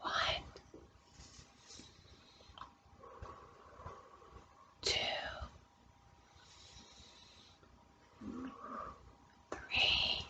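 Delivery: soft whispered count between breaths. Real, not acted. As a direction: whisper each number slowly.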